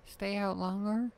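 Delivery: put-on little girl voice.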